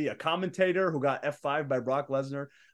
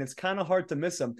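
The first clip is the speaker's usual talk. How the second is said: ordinary talk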